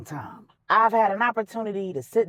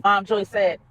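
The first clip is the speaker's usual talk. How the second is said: usual speaking voice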